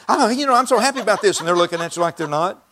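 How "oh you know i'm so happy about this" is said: high, loud gush of happiness; hammed-up delighted enthusiasm; excited. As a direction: act out bubbling excitement, pitched well up. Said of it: "Have you ever been excited talking".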